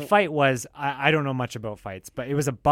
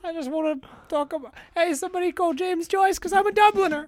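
mock Irish. Joining the mock Irish bit.

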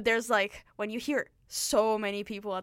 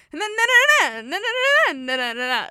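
sing-song exaggerated Italian-style babble; high, loud playful Italian-accent mimicry; mock Italian accent; 9 semitones higher, 10 dB louder than usual. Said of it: "trying to do an Italian accent with it".

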